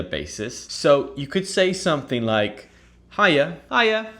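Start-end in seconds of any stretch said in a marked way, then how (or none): 3.2-4.1 high, cheery sing-song greeting; friendly hiya. Shown as a demonstration. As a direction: chirp it like a friendly local. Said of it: "This is an informal way to say hello".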